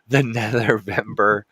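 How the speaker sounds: suppressing a laugh; holding back giggles; trying not to laugh. Real, not acted. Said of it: "I'm trying not to laugh".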